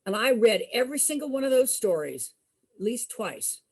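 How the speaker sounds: plain reading voice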